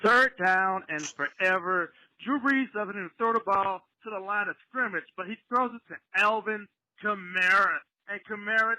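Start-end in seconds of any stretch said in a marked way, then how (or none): none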